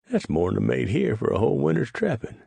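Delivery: put-on old trapper amazed at the payout. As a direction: marvel at the money. Clyde amazed at sum.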